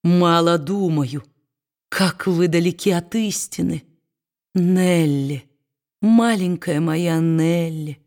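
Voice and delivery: put-on slow, tender old man's voice. A narrator's reading. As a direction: linger lovingly on her name. Old man speaks tenderly of granddaughter.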